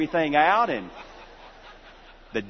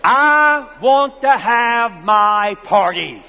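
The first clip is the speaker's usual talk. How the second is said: put-on slow, loud, high wail of a kid; sermon story, boy insists on his party, wailing slowly; whiny child; 4 semitones higher, 6 dB louder, much slower than usual. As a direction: drag it out like a pouting child. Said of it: "the little boy began to scream and cry".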